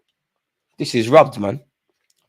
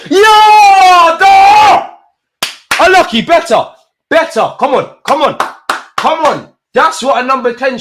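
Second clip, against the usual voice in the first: much higher and louder, urgent cheering; excited shouting; 13 semitones higher, 10 dB louder, slower than usual. Real, not acted. Fan cheering a near miss and urging England on, excited shout. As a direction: roar and urge the team on.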